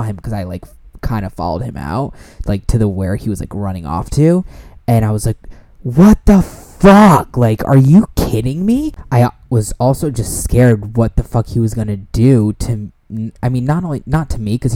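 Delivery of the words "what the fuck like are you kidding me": disbelieving yell. Retold or imitated. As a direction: shout after him in disbelief. Calling after him.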